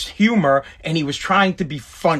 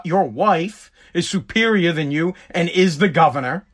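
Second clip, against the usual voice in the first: normal talking voice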